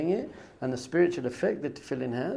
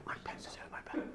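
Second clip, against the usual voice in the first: hushed gossiping whisper; excited whisper; 16 dB quieter than usual. Retold or imitated. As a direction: whisper the name like a secret thrill. Crowd whispering about celebrity visitor.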